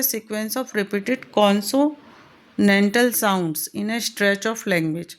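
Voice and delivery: very slow, word by word, for notes; each word spaced out for the class; slow dictation. Shown as a demonstration. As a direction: read it out slowly so they can copy. Teacher dictates definition slowly.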